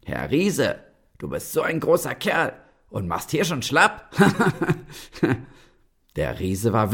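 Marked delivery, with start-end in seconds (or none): 0.1-4.1 high, teasing and mocking; cheeky taunt. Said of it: "einen frechen Spruch". 4.2-5.4 high mocking laugh; cheeky laugh. Tailor mocks the giant.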